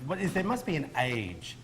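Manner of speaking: ordinary talk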